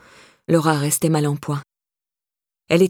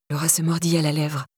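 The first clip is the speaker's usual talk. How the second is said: usual speaking voice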